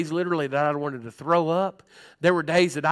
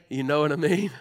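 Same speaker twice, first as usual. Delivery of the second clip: ordinary talk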